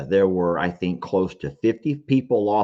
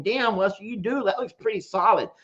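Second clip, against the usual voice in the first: high, lightly voiced viewer teasing him; mock viewer voice; 10 semitones higher than usual. Viewers tease Lester about being solid, high mock voice. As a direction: play a fan pointing out his muscles. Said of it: "You're all saying".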